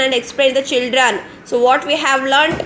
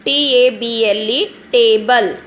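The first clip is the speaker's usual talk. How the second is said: usual speaking voice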